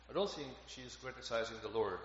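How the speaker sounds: normal talking voice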